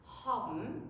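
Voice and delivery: "Haben" ending in a closed-lip m. Shown as a demonstration. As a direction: demonstrate "Haben" as "habem". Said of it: "you can try to say it".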